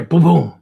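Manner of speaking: loud punchy "boom boom"; quick loud vocal booms; imitated boom. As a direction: punch out a sound effect. Voices the swish being drawn.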